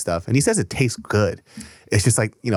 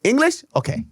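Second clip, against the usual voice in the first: high mock surprised "English?"; light impression of a confused waitress; imitating the waitress; 13 semitones higher than usual. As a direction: squeak it up like a puzzled old waitress. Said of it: "She goes".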